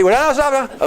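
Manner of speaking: high, quick "stop" to a group; high-pitched urgent halt; urgent calling off. Real, not acted. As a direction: call them back before they start. Halting people who start too early.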